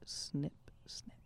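whispered snip sounds; ASMR whisper. Shown as a demonstration. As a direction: breathe the snips, ASMR style. Hair cutter ASMR demo.

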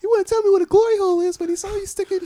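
put-on fast, high kid's voice; squeaky boy pressing mom; child voice. Voicing a young son.

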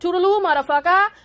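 high, loud, sing-song "Toodaloo, motherfucker"; sassy sign-off. Shown as a demonstration. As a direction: wave them off with a cheeky high farewell. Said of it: "four or five different ways".

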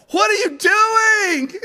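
high, slow, loud shocked yell; a mother catching her kid wrecking the china, yelling; mother bursts in and yells at son, high and loud; mom's shout. Retold or imitated. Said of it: "Mom coming in and asking me".

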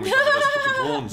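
laughing. Real, not acted. Laughing while recalling a movie line.

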